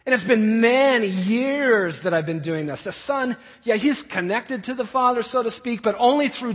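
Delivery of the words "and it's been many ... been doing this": loud, raised-voice grievance; indignant son. Acted. Older son complaining to his father, loudly indignant. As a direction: protest hard as the wronged older son.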